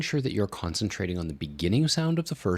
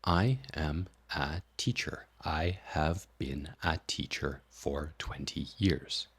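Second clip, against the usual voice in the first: words still separated, a little faster, hushed; sped-up separated words; 6 dB quieter, slower than usual. Shown as a demonstration. Teacher demonstrating a faster separated version. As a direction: still staccato but quicker. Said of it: "And then you can speed this up".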